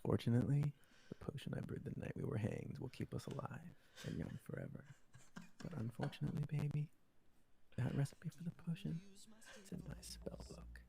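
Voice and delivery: put-on straight voice. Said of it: "I'm gonna do what Alana likes".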